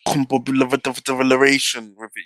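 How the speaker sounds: ordinary talk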